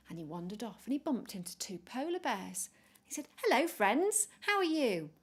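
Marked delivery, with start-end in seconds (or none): 3.4-5.1 higher, louder chirpy penguin hello; cheery greeting. Acted. Peppy greeting two polar bears, high and cheery. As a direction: greet them brightly.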